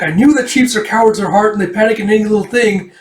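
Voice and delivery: high-pitched, worked-up ramble; agitated babble. Retold or imitated. Smith rambles about sheep after fleeing, agitated.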